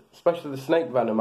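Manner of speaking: normal talking voice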